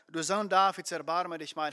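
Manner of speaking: usual speaking voice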